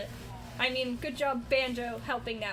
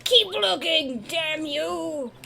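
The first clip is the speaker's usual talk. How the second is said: higher, louder angry squirrel; hangry squirrel voice; 4 semitones higher, 8 dB louder, much slower than usual. Acted. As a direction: snap at them like a hungry critter. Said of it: "That's his voice now".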